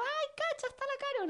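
put-on excited kid voice. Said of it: "así los niños".

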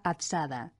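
z of azada voiced as dz; old Castilian azada. Shown as a demonstration. Old pronunciation of azada, voiced dz for z. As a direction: buzz the z in azada as dz. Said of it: "La pronunciación de azada era".

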